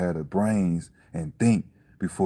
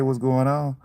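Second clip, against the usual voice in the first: higher-pitched, laughing as you say it; amused greeting; 6 semitones higher, 4 dB louder than usual. Real, not acted. Speaker repeating a greeting to someone, laughing.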